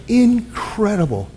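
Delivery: usual speaking voice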